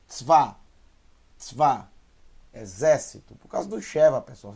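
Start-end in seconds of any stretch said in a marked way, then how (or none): 0.1-1.9 TZVA in one quick, punched syllable; quick reading. Shown as a demonstration. Teacher showing how fast Tzva is, one quick syllable. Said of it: "Olha só como é rápido".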